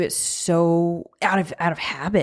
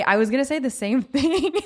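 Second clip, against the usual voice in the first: laughing; 7 semitones higher than usual. Real, not acted. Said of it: "Why are you laughing?".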